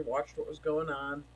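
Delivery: ordinary delivery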